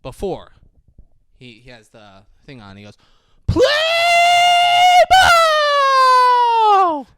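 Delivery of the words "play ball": high, loud yelled call; hammed-up umpire shout; movie shout. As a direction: bellow the famous line. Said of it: "very famous line from the movie".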